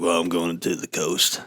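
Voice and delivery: a hint of a self-satisfied Harley rider; exaggerated biker guy. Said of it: "I'm exaggerating his voice a little bit".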